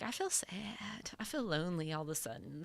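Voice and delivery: small, hushed mock-sad voice; hushed pretend loneliness. Mimics feeling lonely in club.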